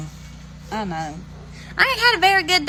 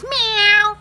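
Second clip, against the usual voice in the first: a pleading house cat, higher and louder; mock cat meow; 11 semitones higher, 5 dB louder than usual. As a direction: meow it high and a bit louder, like a cat begging. Cat begging for food.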